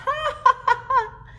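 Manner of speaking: loud high-pitched belly laugh; big hearty laugh. Acted. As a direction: laugh out loud like a tickled boy. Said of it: "laughed and laughed".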